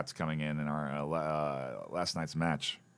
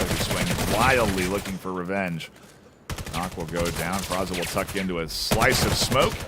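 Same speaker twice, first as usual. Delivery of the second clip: esports caster; 4 dB louder than usual. Real, not acted. Live play-by-play of a match.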